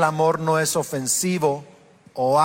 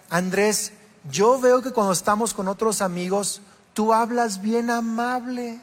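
high, slow, pleading imitation of the wife; wife's pleading; 6 semitones higher, slower than usual. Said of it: "Kelly me decía". (esposa reclamando con voz aguda y lenta)

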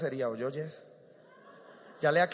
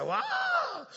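mock cry of jubilation. Preacher mimicking young people in church, high mock cheer. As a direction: imitate a congregation's joyful yell. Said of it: "Grito de júbilo".

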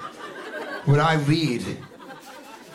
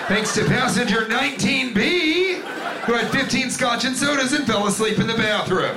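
higher-pitched, pointed mock thanks; pointed announcement; 7 semitones higher than usual. Pilot thanking passenger nineteen B, pointed high announcement. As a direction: imitate a pilot publicly calling someone out.

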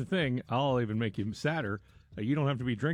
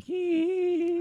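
much higher put-on giggle; hammed-up high giggle; mock giggle. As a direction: squeak a silly little giggle. Imitating women's giggle.

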